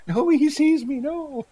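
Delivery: high, loud laughing protest; mock dismay. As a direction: cry out in playful despair. Player dreads seeing father.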